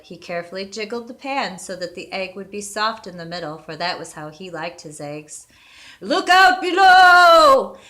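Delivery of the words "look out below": high, slow, loud wailing warning; frightened ghostly cry. A narrator's reading. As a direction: cry out like a ghost scared of falling. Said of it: "sounding shaky and frightened".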